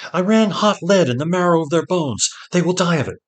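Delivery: high, loud, desperate insistence; alarmed woman urging escape; frantic urgency. A narrator's reading. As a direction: hurry him, voice climbing to a final cry. Seret urges hesitating Ged to flee.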